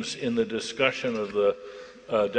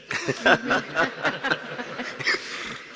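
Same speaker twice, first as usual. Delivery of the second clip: breathless high wheezy laugh; wheezing laugh; 12 semitones higher than usual. Real, not acted. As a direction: laugh silently at your own joke. Laughing at own anecdote.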